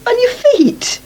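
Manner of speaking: high, amazed exclamation; can't believe what she's seeing; incredulous. Acted. Aunt to nephew about his boots, high incredulous.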